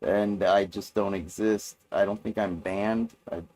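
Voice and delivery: normal talking voice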